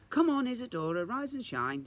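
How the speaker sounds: high, loud cheery mum voice; sing-song morning call from mum; mum's wake-up call. Acted. Mum waking Isadora, cheery and loud.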